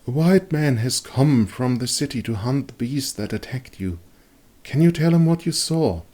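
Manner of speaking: a hint of a kind nurse; lightly voiced Irmgard. A narrator's reading. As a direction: coax the sick man to talk. Irmgard speaks to patient.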